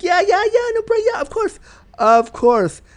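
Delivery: high, gushing mock agreement; high-pitched, rushed people-pleasing yeses; over-eager agreement. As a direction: imitate someone way too into it. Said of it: "way into the idea way too fast".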